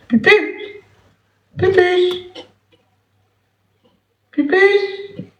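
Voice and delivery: very high falsetto call; sing-song call to a pet; yelling across the room. Real, not acted. Appelle la pupus partie. (appel aigu en fausset)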